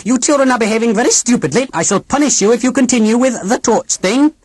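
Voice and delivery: high, clipped mechanical scolding; stern robot aunt. A narrator's reading. Robot aunt scolding the children, high and stiff. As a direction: scold the children like a cold machine nanny.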